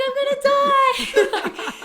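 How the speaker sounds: high, loud and slow, laughing through it; terrified wail. Retold or imitated. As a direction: wail in mock terror. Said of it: "so scared".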